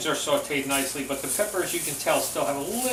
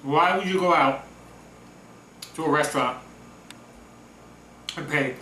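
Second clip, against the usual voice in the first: talking with mouth full. Real, not acted. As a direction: speak through a mouthful of food. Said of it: "I'm talking with my mouth full".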